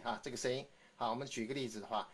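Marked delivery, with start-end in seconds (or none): none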